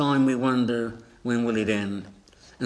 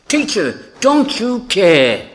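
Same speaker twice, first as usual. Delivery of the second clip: loud, high panicked cry, drawn out; frightened yell for help; terrified scream; 7 semitones higher, 9 dB louder, slower than usual. Acted. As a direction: shout it desperately over a storm. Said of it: "they screamed at Jesus".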